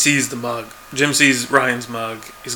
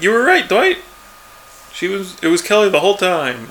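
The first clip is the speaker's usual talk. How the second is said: higher, louder acted quote; mock-excited concession, pitched up; imitated excited admission; 5 semitones higher, 3 dB louder than usual. Jim tells Dwight he was right, imitated excited admission.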